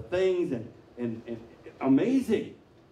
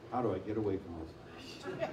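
soft, lower mock dismay; mock panicked regret; 6 semitones lower, 9 dB quieter than usual. Preacher voicing a man regretting a fling, hushed. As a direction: mutter it like you want out.

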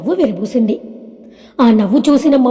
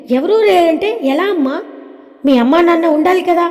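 put-on higher-pitched child voice, questioning; puzzled little girl; 4 semitones higher than usual. Daughter asking mother about missing grandparents, puzzled. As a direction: puzzle it out in a small child's high voice.

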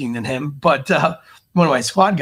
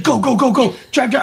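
higher, louder rapid go go go; retold wife begging him to drive, raised and urgent; frantic urging; 9 semitones higher, 3 dB louder than usual. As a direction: hurry the driver out of there in a panic.

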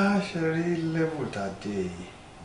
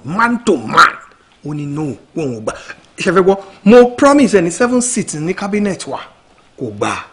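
much louder, heated complaint; exasperated; 4 semitones higher, 10 dB louder than usual. Acted. Aide complaining to Honorable about stubborn rival, heated. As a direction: vent that he just won't agree. Frustrated report of refusal.

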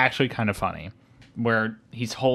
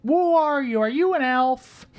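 put-on high-pitched, drawn-out kid voice; mock child's singsong question; excited kids; 4 dB louder than usual. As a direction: imitate a bunch of kids, pitched way up. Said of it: "a bunch of kids are like".

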